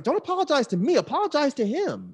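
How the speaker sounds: high, loud scolding from a retold argument; retold indignant demand. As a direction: replay telling someone off, pitch way up. Retelling demand for an apology.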